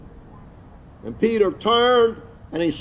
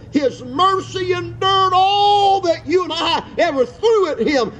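full-force preaching; 5 semitones higher than usual. Acted. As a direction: preach it home, stretching every word. Preaching climax with a crying voice.

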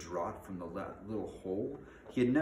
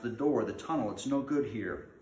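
raised, loud warning; pitched-up urgent call; gasped urgency; 4 semitones higher, 6 dB louder than usual. Acted. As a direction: urge them on in acted alarm. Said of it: "he gasped".

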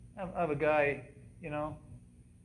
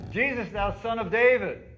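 loud, high call of a beggar; crying out; 7 semitones higher, 6 dB louder than usual. Retold or imitated. Blind beggar calling to Jesus, loud high cry. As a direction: cry it out across the crowd.

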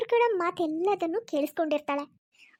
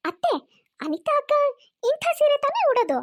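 higher, dismissive daughter-in-law; snide retort; 6 semitones higher than usual. Acted. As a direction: brush off the complaint with contempt. (ತಿರಸ್ಕಾರದ Uttara)

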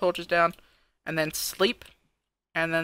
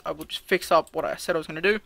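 normal talking voice

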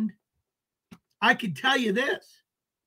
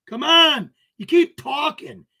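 loud taunt; 3 semitones higher, 7 dB louder than usual. Real, not acted. Taunting a chat troll.

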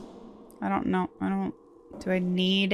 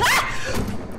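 startled scream. Real, not acted. Startled by something in game.

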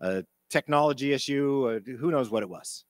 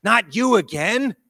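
exasperated; 6 semitones higher, 6 dB louder, slower than usual. Retold or imitated. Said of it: "worked with so many times already".

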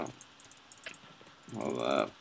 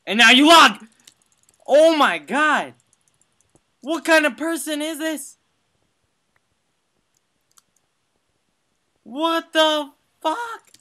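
high, loud disbelieving shout; outraged shouting; 13 semitones higher, 7 dB louder than usual. Real, not acted. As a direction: yell in outrage. Opponent logs out mid-fight.

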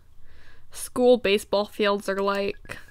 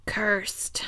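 softer, hushed spooky whisper; 6 dB quieter than usual. Pretend. Playing a witch about the knuckleball, hushed spooky whisper. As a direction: breathe it like casting a hex. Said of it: "She's casting spells out here".

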